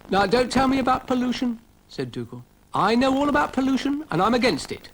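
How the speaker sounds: a pompous little dog protesting; Dougal the dog. Acted. Dougal insisting to Florence, high fussy voice.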